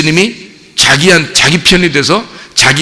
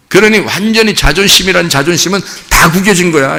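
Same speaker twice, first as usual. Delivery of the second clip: usual speaking voice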